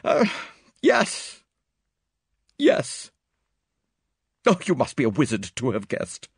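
high, anguished woman; agitated, halting admission then protest; distraught Miss Howard. A narrator's reading. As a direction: resist the idea in a pained high voice.

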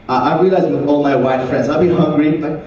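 usual speaking voice

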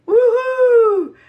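high, loud joyful woohoo; excited whoop. Real, not acted. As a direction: cheer it out like you nailed it. Cheering over finished plate.

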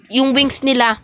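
normal talking voice

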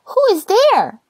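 high, loud call through the door; little rosebud demanding who knocks. Acted. Rosebud calls out again.

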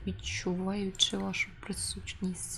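quiet, half-whispered story narration; hushed narration. Acted. Reads game narration.

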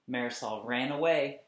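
ordinary delivery

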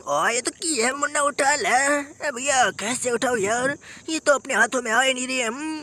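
ordinary delivery

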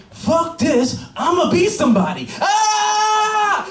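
high-pitched, hammed-up charge ending in a scream; rally yourself, then scream into the race; defiant battle cry. Sperm cell charging in race.

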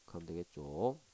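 normal talking voice

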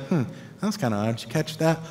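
soft, puzzled aside to a friend; quietly wondering what he meant; puzzled murmur. Retold or imitated. A disciple murmuring quietly to a fellow disciple.

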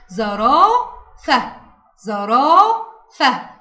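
"زرافة" very slowly, the "raa" held long; long vowel shown. Shown as a demonstration. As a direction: stretch the alef in "زرافة". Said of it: "مد بالألف". (مدّ الألف في "زرافة")

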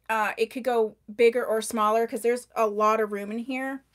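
normal talking voice

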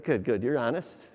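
normal talking voice

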